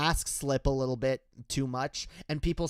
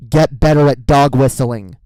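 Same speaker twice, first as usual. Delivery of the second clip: a real, full shout, pitched lower; shouted advice; 5 semitones lower, 16 dB louder than usual. Real, not acted. Ranting vlogger shouting advice at right-wingers, full furious shout. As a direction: bellow it at them.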